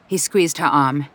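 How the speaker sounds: usual speaking voice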